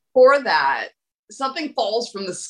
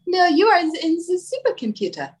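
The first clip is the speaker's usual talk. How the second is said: higher-pitched mock French accent; playful, rough French-accented character voice; 8 semitones higher than usual. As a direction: answer in a put-on French accent. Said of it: "Your French is better than mine".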